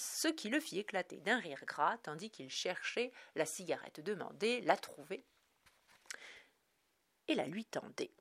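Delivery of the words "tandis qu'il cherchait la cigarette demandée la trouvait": ordinary delivery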